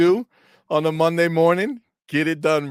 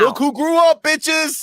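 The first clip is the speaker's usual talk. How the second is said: much higher, louder gleeful shout; hype shout; 13 semitones higher, 3 dB louder, slower than usual. Real, not acted. Cheering on the host's rant, excited and loud. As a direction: crow it out with glee.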